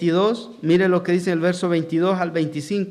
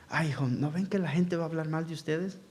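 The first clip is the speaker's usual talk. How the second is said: much softer, a timid father's mild scolding; half-hearted hushed reproach; mock low voice; 9 dB quieter than usual. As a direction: murmur it so nobody overhears. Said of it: "Yo me lo imagino hasta en voz baja".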